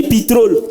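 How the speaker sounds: pétrole said as pitrol, e turned to i; Banda pétrole. Shown as a demonstration. Comedian to the audience, mocking the Banda pitrol. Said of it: "remplacer les E par I".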